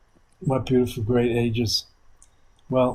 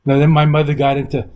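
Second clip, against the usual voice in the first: ordinary talk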